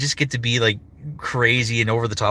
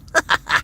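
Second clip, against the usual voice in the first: high, short giggle; character giggle; 6 semitones higher than usual. Acted. Finishing his Pico voice for viewers, high giggle. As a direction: giggle in quick high bursts. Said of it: "another voice that I love doing is Pico".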